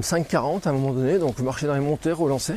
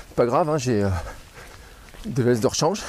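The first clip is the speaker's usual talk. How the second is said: normal talking voice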